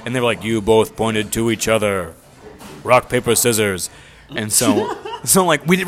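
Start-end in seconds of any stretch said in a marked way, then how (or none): 0.4-3.9 slow, loud imitation of an intercom voice; echoing voice. Intercom voice rules on the sacrifice, slow and echoing. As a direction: announce it slowly, as if echoing overhead. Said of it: "it was like an echoing voice".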